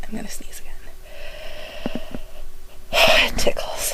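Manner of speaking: whisper, sneeze coming. Real, not acted.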